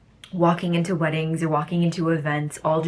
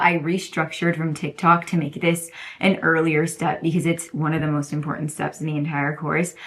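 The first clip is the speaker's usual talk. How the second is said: usual speaking voice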